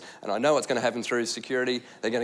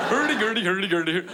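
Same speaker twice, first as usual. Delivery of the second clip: singsong mock-Swedish babble; lilting fake Swedish; Swedish chef; 5 semitones higher than usual. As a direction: do a bad puppet-chef impersonation. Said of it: "do their own Swedish chef impersonation".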